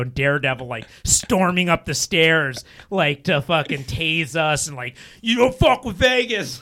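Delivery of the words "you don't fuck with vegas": higher, louder mock thug voice; tough-guy threat. Imagined hotel thugs threatening the tripping guest, mock menace. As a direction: growl it like a casino heavy.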